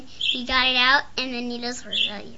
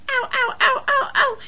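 high repeated ow of pain; faking pain; 13 semitones higher, 6 dB louder than usual. Retold or imitated. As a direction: whimper ow ow, pitched up. Nana crying ow in car.

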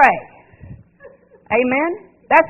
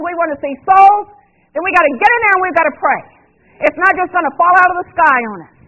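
rising, louder preaching push; 5 semitones higher, 5 dB louder than usual. Acted. Pastor urging the church to pray harder, preaching at full force.

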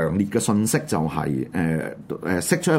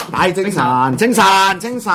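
higher, louder rallying call; rousing greeting; 9 semitones higher, 4 dB louder than usual. Real, not acted. Host rouses listeners despite heavy news, rousing call. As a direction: call out to perk everyone up.

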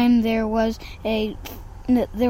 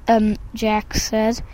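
ordinary delivery

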